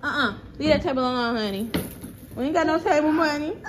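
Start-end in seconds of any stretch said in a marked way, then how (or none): none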